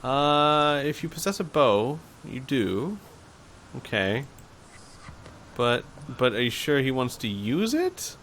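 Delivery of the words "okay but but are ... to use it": ordinary delivery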